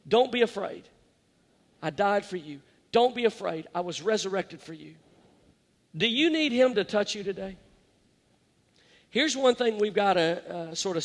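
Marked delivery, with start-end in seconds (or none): 0.1-5.0 soft, low, consoling voice of Jesus; tender comfort. Retold or imitated. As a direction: lower and much softer reassurance.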